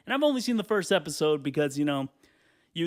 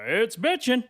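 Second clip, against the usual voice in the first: loud, higher salesman's catchphrase; relishing a tagline; 7 semitones higher, 5 dB louder, slower than usual. Acted. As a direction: shout the slogan like you love saying it. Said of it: "this guy must have loved saying it".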